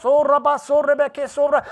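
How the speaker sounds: demonstrated burst of tongues; praying in tongues. Shown as a demonstration. Preacher showing congregation praying in the Holy Ghost, demonstrated tongues. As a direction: rattle off syllables as if praying in tongues. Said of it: "you start praying in the Holy Ghost".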